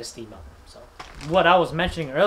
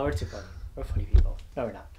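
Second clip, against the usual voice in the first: usual speaking voice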